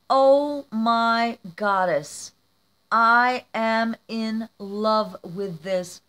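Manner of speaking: loud, slow, drawn-out rapture; awed delight. Real, not acted. Woman adoring her friend's handmade gift, awed. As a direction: stretch each word in emphatic awe.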